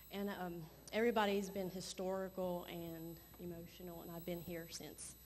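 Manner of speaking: ordinary delivery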